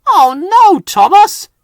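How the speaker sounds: high, loud protest; cut-off engine protest. A narrator's reading. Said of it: "James began".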